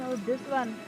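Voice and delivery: normal talking voice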